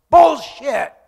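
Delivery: sudden explosive high shout; full-force outraged bellow; yelled heckle. Retold or imitated. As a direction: yell it from the back of the audience. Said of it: "and yells".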